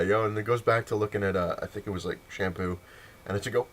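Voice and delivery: ordinary talk, nothing marked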